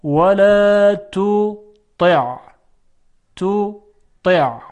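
very slow ولا تطع, thin ت then thick ط; drawn-out teaching model of ولا تطع; light then heavy ta. Shown as a demonstration. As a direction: break تطع into two beats, light then emphatic. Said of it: "اول ت هست".

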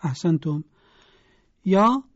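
ordinary talk

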